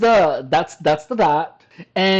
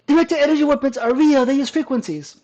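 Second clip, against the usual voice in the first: mock conspiracy voice; 8 semitones higher than usual. Quoting the short mockingly.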